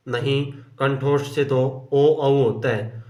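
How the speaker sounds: usual speaking voice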